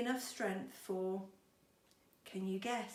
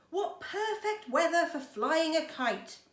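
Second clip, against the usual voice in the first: bright, louder, higher clever-clogs voice; higher and louder, pleased with himself; Mister Clever voice; 7 semitones higher, 7 dB louder than usual. Acted. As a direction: play the pleased-with-himself character.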